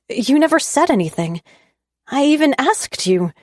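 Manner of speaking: shocked. A narrator's reading. Shocked hurt protest.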